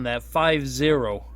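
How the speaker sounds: ordinary talk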